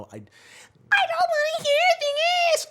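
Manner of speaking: very high, slow, strained imitation; shrill high voice. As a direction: squeak it way up high, slowly. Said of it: "six octaves above the normal".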